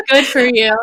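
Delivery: loud teasing "Good for you"; mock approving. Teasing a friend's confession, loud mock praise. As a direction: congratulate her with a loud joking edge. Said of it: "No, I'm kidding".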